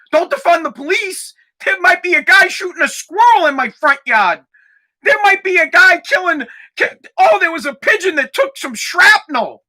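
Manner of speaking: high, hammed-up alarm; mock panic. Mocking a privileged woman begging for police, mock panic. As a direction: imitate an overwrought caller, pitch climbing as it goes.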